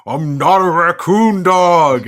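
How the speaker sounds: slow, loud, high monster roar; hammed-up drawn-out yell. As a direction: bellow it out, stretching each word. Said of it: "and he yells".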